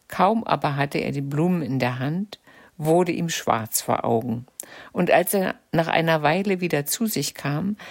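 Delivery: plain reading voice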